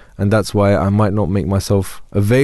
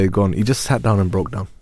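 normal talking voice

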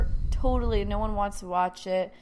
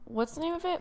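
whispered, half-voiced question; a sleepy whisper; 9 semitones higher, 4 dB quieter than usual. Real, not acted. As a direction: breathe the question out without voice.

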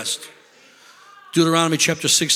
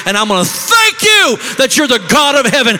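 high, loud preaching cry; shouted praise; 8 semitones higher, 6 dB louder than usual. Real, not acted. Preacher to congregation, rising shout of praise. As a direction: lift it up in full-voiced praise.

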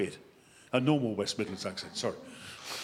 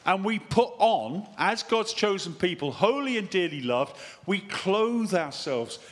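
high, emphatic pulpit reading; scripture reading; 7 semitones higher, 6 dB louder, slower than usual. Real, not acted. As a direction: proclaim it slowly and deliberately to the congregation.

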